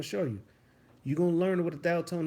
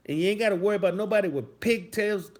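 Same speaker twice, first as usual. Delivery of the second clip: ordinary talk, nothing marked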